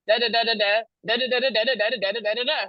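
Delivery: rhythmic run of "da"s for forgotten words; steady nonsense-syllable recitation; da-da filler. Shown as a demonstration. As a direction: rattle through a verse on "da". Stand-in for a forgotten verse.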